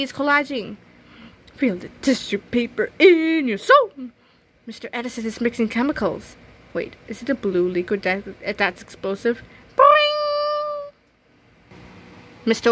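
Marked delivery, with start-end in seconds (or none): 1.5-3.9 dreamy art teacher. Acted. 9.8-10.9 high, louder cartoon boing; spring it out like a cartoon; boing sound effect. Chemistry mishap, a high cartoon boing.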